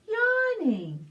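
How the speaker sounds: yawning the word, then a high sighing yawn; high pretend yawn; acted yawn. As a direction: stretch the word into a real yawn. Reader yawns like the kitten.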